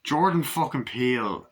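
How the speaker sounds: ordinary talk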